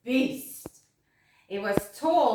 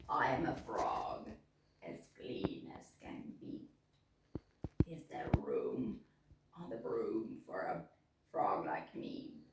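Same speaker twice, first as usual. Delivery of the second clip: much lower, soft croaky whisper; croaking frog; 13 semitones lower, 9 dB quieter than usual. A narrator's reading. Frog asks the witch for room. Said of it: "then said with a croak".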